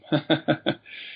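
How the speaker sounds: light real chuckle; short laugh. Real, not acted. Guest laughing about finding his dad, light chuckle.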